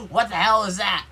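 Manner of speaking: loud, high, startled shout; uncle's angry yell. Retold or imitated. Uncle bursting in over the blaring radio, angry shout. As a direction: bark it like a woken, furious uncle. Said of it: "Uncle Steven comes up and he's like".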